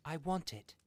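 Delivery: plain reading voice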